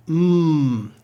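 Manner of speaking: "Mm" as a long closed-lip hum; held M sound. Shown as a demonstration. Teacher isolates mu's sound, held M. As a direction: hum a sustained "Mm". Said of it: "it is their M sound".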